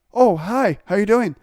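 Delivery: high, loud and overjoyed hello; overly cheery phone hello; ecstatic greeting. Retold or imitated. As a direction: gush like you're thrilled to hear from them. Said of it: "answered so ecstatic".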